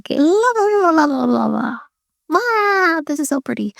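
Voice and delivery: high, singsong la la; wild child voice. Retold or imitated. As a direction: play the giddy wild child. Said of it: "wild child version of Estine".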